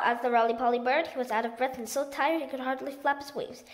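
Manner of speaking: usual speaking voice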